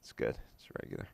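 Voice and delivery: unvoiced, very quiet mumble; mumbled kid. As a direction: whisper it like a sulky kid.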